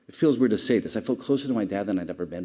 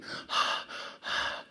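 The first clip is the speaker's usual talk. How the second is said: heavy sigh; 6 dB quieter than usual. Shown as a demonstration. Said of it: "They're breathing".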